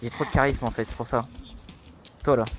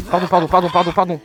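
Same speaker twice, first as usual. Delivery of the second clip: loud rapid pardons; loud apology; 6 semitones higher, 8 dB louder than usual. Real, not acted. Gamer loudly apologising to a teammate, louder. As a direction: shout the sorries over and over.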